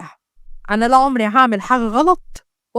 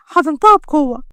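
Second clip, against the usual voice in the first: ordinary delivery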